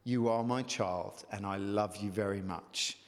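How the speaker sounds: hushed, deep reassurance from the Spirit; loving inner voice. Acted. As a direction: speak low and gently, like a father reassuring a child. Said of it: "It's the spirit that says".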